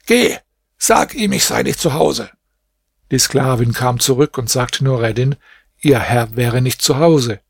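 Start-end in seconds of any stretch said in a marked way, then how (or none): 0.1-2.3 angry shout. A narrator's reading. Master yelling at his slave girl: sudden high shout. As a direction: bark the order, then drop to a grumble. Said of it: "Ihr Herr schrie sie an".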